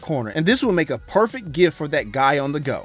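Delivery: smooth sponsor read over music. Acted. Host reading a Manscaped sponsor spot, upbeat ad voice. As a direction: sell the razor like a podcast host ad spot.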